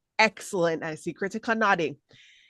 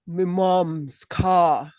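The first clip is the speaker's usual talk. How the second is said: "Me mom's car" dropped lower, very slow, with bass; lower, deeper voice; 4 dB louder, much slower than usual. Shown as a demonstration. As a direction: drop down and give "Me mom's car" some bass. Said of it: "if you went down a bit and went".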